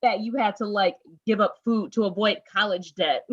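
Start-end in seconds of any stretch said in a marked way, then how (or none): 0.0-0.7 higher, teasing scold to your younger self; sassy self-lecture. Retold or imitated. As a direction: wag a finger at the dreamer, half laughing. Lecturing her past self, sassy.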